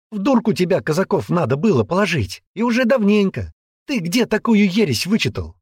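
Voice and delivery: high, derisive retort; scornful girl. Acted. Girl scoffing at the man's theory, high scorn.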